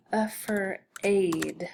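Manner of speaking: "a fur aid" tapped slowly as uh, f, r, aid; sounded out. Shown as a demonstration. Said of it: "It could be a I".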